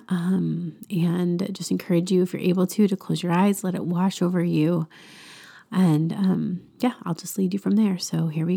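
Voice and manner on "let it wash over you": ordinary talk, nothing marked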